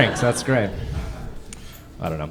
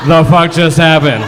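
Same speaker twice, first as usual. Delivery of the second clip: loud stunned shout; shocked shout; 2 semitones higher, 7 dB louder than usual. Retold or imitated. Teacher reacting to a blind boy's joke, shocked shout. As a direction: yell it in disbelief. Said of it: "And I was like".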